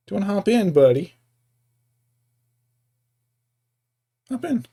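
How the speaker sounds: high sing-song coaxing to a pet. Real, not acted. Streamer coaxing Pancakes into his bed, high coaxing pet voice. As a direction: coax the dog into his bed, pitched high.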